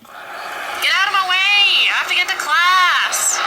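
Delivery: loud pushy yell; shouting. Shown as a demonstration. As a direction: yell to clear the way. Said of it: "that was lame".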